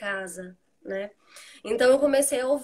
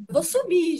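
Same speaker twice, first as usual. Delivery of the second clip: higher, louder mock threat; imitating fed-up mother; 7 semitones higher, 5 dB louder than usual. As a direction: act out a mother's exasperated outburst. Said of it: "quando a minha mãe falava". (imitando mãe farta, mais alto e agudo)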